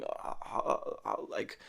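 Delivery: breathless, voiceless choking noises; a kid's mock choking sounds; fake choking gasps. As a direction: fake a choke with airy gasps. Kid fakes choking in story.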